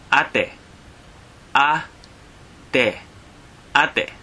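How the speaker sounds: "ate" as AH-teh, two syllables; Tagalog word for sister. Shown as a demonstration. Teacher pronouncing "ate" for learners. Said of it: "Sister is".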